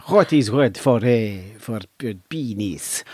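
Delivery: slow, halting mock Spanish accent; hesitant imitation of a Spanish speaker; put-on Spanish accent. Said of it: "he pretended he was Spanish".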